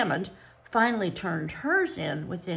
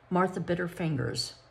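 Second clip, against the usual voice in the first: ordinary delivery, nothing marked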